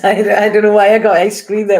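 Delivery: amused laugh over ice cream there; laughing at herself. Real, not acted. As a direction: giggle at your own slip of the tongue. Repeats the slip, laughing.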